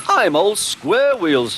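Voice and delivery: high, slow indignation; slow, fuming high voice. A narrator's reading. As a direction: drag the words out in a huff. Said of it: "fumed Henry".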